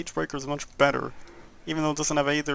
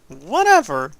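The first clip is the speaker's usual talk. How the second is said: loud dismissive exclamation; loud whatever; 9 dB louder, slower than usual. Real, not acted. Gamer shrugging off empowered loot, loud dismissive. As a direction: brush it off loudly.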